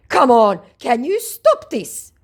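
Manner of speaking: high, loud, drawn-out whiny scammer voice; mocking scammer. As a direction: mimic the pushy scammer, whining it out slowly.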